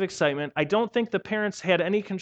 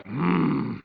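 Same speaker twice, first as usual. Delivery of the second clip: disapproving bigwigs; 7 semitones lower, slower than usual. Retold or imitated. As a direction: sniff like a stuffy official. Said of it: "they're like".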